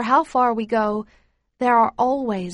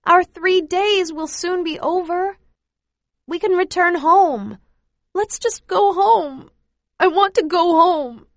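halting sobs; 8 semitones higher, 5 dB louder than usual. Acted. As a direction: plead to go home through tears. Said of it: "come out between halting sobs".

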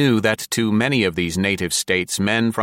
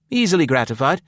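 put-on high, youthful young officer's voice; Blaine; 7 semitones higher, faster than usual. A narrator's reading. Young officer obliging his senior, high youthful voice. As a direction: answer brightly in a raised young man's pitch. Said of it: "said the youthful Blaine".

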